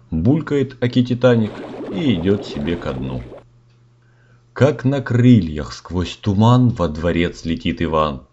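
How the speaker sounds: usual speaking voice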